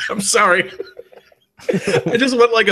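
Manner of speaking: giggling through the apology; laughing. Real, not acted. Player laughs at the scene.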